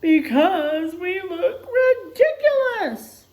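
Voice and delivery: high, loud, slow wail; wailing chorus. Acted. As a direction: complain tearfully together. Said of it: "says everyone together".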